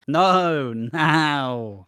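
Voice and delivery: firm loud insistence; insistent. Retold or imitated. Retold insistent reply.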